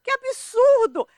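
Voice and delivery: high, loud, outraged disbelief, slowing at the end; scandalized protest at the family; indignant outburst. Retold or imitated. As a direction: relive the indignation, pitch soaring. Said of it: "Eu falei".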